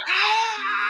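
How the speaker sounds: high sustained screech; monster yell. Acted. Game master as monster yelling into player's ear, screeching. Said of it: "try to yell into your ear".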